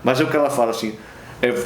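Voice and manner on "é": ordinary delivery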